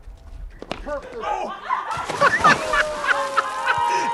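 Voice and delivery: high, loud burst of laughter; delighted high laugh; real laugh. Real, not acted. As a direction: laugh out at the clip. Laughing at the replay.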